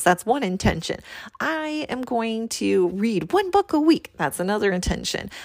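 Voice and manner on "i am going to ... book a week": put-on, higher resolution voice; mock goal declaration. Coach quoting her own pile of intentions, mock declaration. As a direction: imitate yourself announcing yet another goal.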